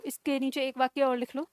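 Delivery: normal talking voice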